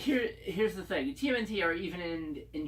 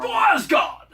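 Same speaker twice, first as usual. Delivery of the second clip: much higher, shouted war cry; viking battle cry; 15 dB louder, slower than usual. Pretend. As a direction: roar it like charging into battle.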